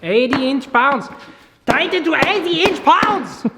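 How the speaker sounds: high loud announcing voice; mock German engineer. Mock-reading torque spec.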